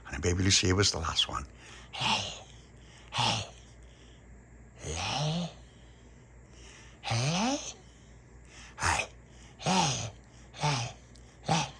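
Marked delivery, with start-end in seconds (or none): 1.9-11.7 quiet eerie hey calls; soft creepy calls. Retold or imitated. Baby Lucia the last seeker, soft eerie calls. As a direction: croon each hey softly and creepily. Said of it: "like this".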